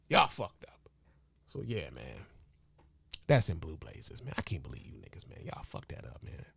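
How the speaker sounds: breathy whispered scolding, fading out. Real, not acted. Disappointed vlogger whispering his letdown to his audience. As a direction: drop to a whisper, almost to himself. Dejected rant trailing off.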